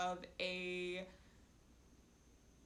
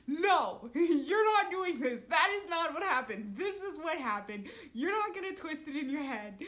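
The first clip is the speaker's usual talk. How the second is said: higher, louder exasperated correction; imitating roommate; 6 semitones higher, 6 dB louder than usual. Roommate correcting her during a fight, louder and higher. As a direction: firmly set her straight, half laughing. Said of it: "she'll just sit there and look at me and go".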